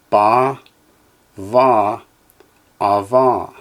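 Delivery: each syllable said slowly and clearly, with a pause after it; teacher's careful drill voice; Hebrew syllables for echoing. Shown as a demonstration. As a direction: model every Hebrew sound for the class to repeat. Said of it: "Echo me, please".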